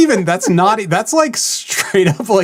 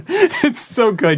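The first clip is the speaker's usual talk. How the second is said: normal talking voice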